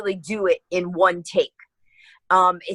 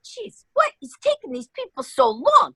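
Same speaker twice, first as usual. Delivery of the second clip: impatient grumble; 12 semitones higher than usual. Retold or imitated. Impatient aside while waiting in line.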